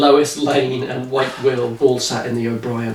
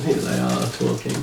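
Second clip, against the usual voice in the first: normal talking voice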